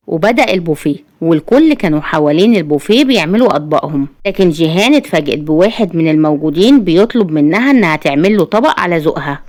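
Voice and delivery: usual speaking voice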